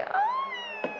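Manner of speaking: a very high, soft yelp of fright; startled squeal. Real, not acted. Visitor startled by something in the dark, a high squeal. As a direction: squeak in sudden alarm.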